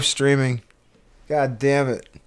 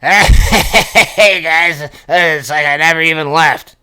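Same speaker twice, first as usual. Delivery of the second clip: louder, unfamiliar-sounding mock greeting; Mark Glista impression; 4 dB louder, much slower than usual. Streamer imitates a returning guest for friends, louder put-on voice. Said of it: "Let me see if I can do a Mark Glista".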